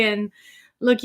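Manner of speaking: ordinary talk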